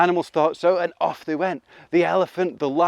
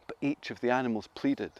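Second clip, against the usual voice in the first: ordinary delivery, nothing marked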